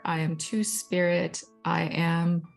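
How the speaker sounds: normal talking voice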